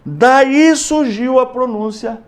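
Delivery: normal talking voice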